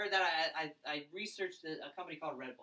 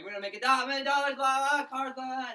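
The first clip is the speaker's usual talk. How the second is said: much louder, higher mock hype; amped-up recruiter; 8 semitones higher, 11 dB louder than usual. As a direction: ham up the energy-drink guy.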